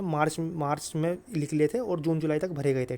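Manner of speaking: ordinary talk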